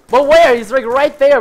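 high, loud exasperation; frustrated outburst. Real, not acted. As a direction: blurt it out loudly, exasperated. Frustrated, cannot shoot enemy.

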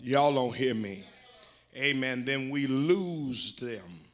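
loud, emphatic exhortation to the congregation; fired-up preaching. Real, not acted. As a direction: press the warning home with force. Preaches with raised force.

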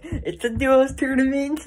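slow, puffed-up esports pro announcement; Fortnite pro voice. Retold or imitated. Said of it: "like, professional saying that".

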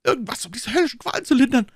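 high, fast, loud desperate begging; tortured man pleading, near breaking; agonized plea. A narrator's reading. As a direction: plead through the pain.

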